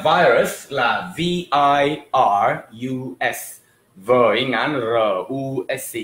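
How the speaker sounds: letters spelled out one by one, slowly; slow spelling. Shown as a demonstration. Teacher spelling virus slowly for students. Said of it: "Sau về đánh vần nha".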